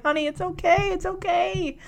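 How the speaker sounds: soft, high, gentle reassurance; motherly soothing. Retold or imitated. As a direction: imitate a mother comforting her daughter. Mother soothing upset bride.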